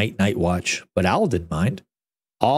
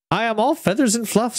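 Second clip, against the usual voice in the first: 1 owl pleading; 9 semitones higher than usual. A narrator's reading. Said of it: "said Owl".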